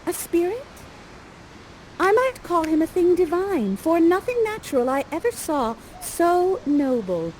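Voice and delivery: put-on high, soft voice of an awestruck young heroine; hushed, high-pitched marvelling; Miranda. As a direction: wonder aloud in lifted, theatrical verse. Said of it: "Okay, and you say".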